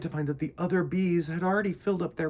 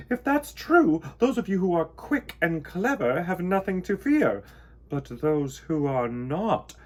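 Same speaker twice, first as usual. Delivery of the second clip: high, loud regal warning slowing at the end; queen warning the hive, eyeing the clumsy bee; 4 semitones higher, 5 dB louder than usual. A narrator's reading. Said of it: "said the queen".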